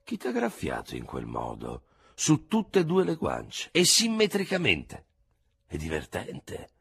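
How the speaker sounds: high, slow wondering question; study his face as you ask; doctor eyeing the scratches; curious old surgeon. Acted. Surgeon observes.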